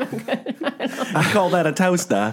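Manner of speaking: high, drawn-out Aussie brag; mock Australian accent. Guest parodying Crocodile Dundee, mock Australian accent. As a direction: ham up an Australian accent, very slowly. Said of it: "I thought about Crocodile Dundee a lot".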